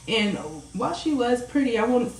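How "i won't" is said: normal talking voice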